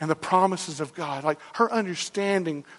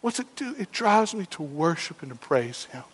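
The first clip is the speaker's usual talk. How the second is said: ordinary talk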